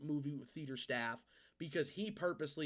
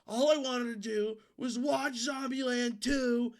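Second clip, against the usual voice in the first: loud, high whiny outburst; flipping out; 8 semitones higher, 7 dB louder than usual. Retold or imitated. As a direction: blurt it out like a man who's losing it. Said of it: "he, like, flipped out".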